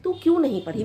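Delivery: ordinary talk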